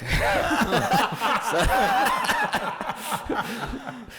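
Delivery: big high laugh at his own punchline; high burst of laughter. Real, not acted. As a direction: crack up hard.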